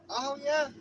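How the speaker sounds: high squeaky cat voice; voicing the cat. Owner voicing his cat, high and squeaky. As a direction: squeak it out like a cute kitty. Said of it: "she's like".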